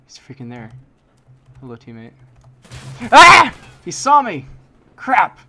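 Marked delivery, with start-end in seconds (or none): 3.1-3.5 loud high shriek of fright; panicked gamer scream; startled scream. Real, not acted. Suddenly spotted by enemy. 3.9-5.4 high, loud frantic cry; spotted and panicking; panicked yell. Real, not acted.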